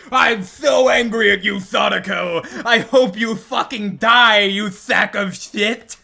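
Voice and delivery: loud, slow, lisped threats; angry lisping New Yorker. Shown as a demonstration. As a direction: spit it out angry with a lisp. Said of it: "except he's speaking like this".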